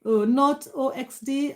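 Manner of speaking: ordinary delivery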